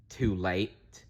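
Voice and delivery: plain reading voice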